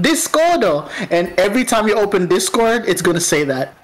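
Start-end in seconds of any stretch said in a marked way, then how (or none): 0.0-0.9 put-on high Japanese-accented announcer sting; imitating a Japanese jingle, pitched up. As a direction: chirp it like a Japanese sound logo. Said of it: "you're gonna hear the Japanese, like, zing going".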